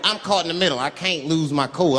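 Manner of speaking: ordinary delivery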